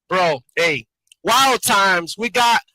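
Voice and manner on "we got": ordinary delivery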